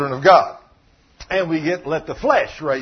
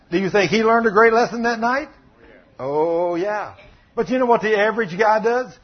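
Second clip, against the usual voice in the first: ordinary delivery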